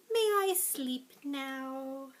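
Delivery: slow, drowsy little owl; sleepy owl. Acted. Teacher voicing the sleepy owl on the last page, slow. Sleepy owl's line in story.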